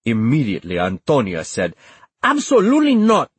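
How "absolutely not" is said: loud, high, emphatic veto; explosive high-pitched no; outraged refusal. A narrator's reading. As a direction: refuse outright with a raised voice. Mother's flat refusal, acted.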